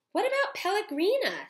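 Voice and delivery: put-on high, loud girl's voice; Abeline's voice. A narrator's reading. Teacher voicing Abeline at dinner, high and loud. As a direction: ask it as an anxious little girl. Abeline's line in read story.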